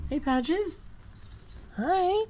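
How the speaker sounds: high, soft cooing to a pet; pet-talk coo. Real, not acted. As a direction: coo sweetly at the little pet. Greeting pet.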